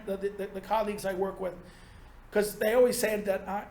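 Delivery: normal talking voice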